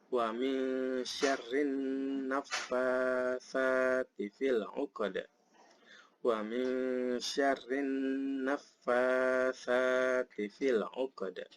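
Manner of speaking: slow, measured tartil recitation; slow Arabic chant with drawn-out nasal holds; Quran recitation. Shown as a demonstration. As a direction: recite slowly with long, held vowels. Recites verse four.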